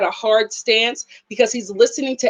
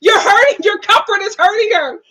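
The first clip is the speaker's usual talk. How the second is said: higher and louder, pleading with a laugh; mock alarmed plea; 8 semitones higher, 5 dB louder than usual. Vlogger pleads with the rough brother, loud laughing alarm. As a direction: beg him to stop, half joking. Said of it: "And I was like".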